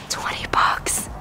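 soft whispered price, stunned; awed whisper. Real, not acted. Shopper whispering a bargain price to viewers, awed. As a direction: breathe the price in disbelief.

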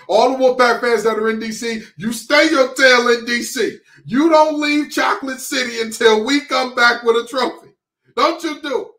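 high, loud command to the fans; emphatic orders. Real, not acted.

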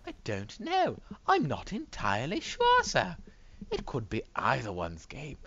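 exaggerated high, swooping announcer voice; pompous hammed-up pundit, words stretched out; put-on commentator. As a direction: play a theatrical commentator weighing the odds. Puts on a commentator character.

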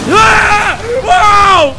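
high, loud yelp into whooping laugh; real shrieking fright breaking into laughter; startled scream. Real, not acted. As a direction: shriek at a jump scare, then laugh it off. Jump scare cuts him off.

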